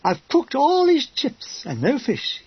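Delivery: high, slow mock-female complaint. A narrator's reading. Soldier moaning about chips without fish, in a female voice. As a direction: complain slowly in a put-on woman's voice. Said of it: "said Dean in a female voice".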